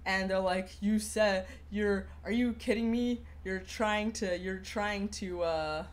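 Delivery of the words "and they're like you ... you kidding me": ordinary delivery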